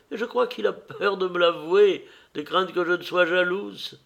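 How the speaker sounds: high-pitched society lady; teasing old lady, high voice; lively old hostess. Acted.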